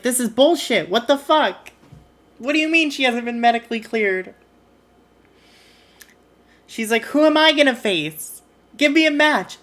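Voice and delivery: hammed-up angry protest; mock outrage. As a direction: play the furious wrestler demanding a match. AJ furious at being denied.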